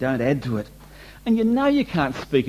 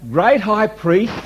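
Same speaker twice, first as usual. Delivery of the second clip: «Great» lifted high, slow and louder; hymn title announced; 6 semitones higher, 5 dB louder, much slower than usual. Acted. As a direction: proclaim it slowly, hymn title. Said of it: "This is Joseph Hart".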